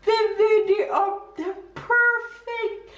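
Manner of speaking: very high, adoring delight; overjoyed. Real, not acted. Wife telling husband his gift is perfect, high overjoyed voice. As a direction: gush high, stretching out the last word.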